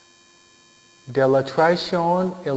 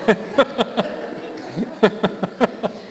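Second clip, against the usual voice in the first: breathy laugh; 7 semitones higher than usual. Real, not acted. Laughs at own joke.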